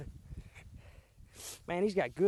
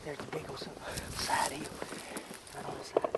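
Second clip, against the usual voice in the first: whispering; 3 semitones lower, 10 dB quieter than usual. Real, not acted. Spotting buck while stalking.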